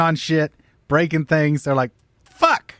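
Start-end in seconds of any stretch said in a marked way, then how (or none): none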